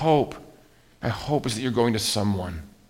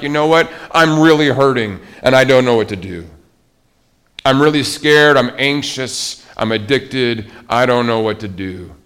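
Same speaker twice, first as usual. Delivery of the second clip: confessing struggle; 3 semitones higher, 8 dB louder than usual. Retold or imitated. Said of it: "you say things like".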